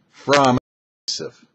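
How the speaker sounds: normal talking voice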